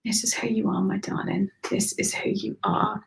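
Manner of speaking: soft whispered affirmation; tender whisper. Real, not acted. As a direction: whisper it like a secret blessing.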